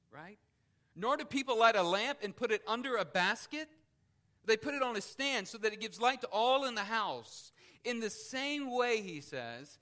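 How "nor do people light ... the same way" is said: louder, higher, ringing out each phrase; preaching at full force. Acted. Preacher reading scripture to the congregation, forceful preaching. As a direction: proclaim it to the whole room.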